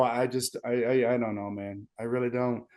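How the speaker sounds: low, soft, defeated admission; dejected. Real, not acted. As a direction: trail down quietly, at a loss. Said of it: "have you ever heard me talk like this".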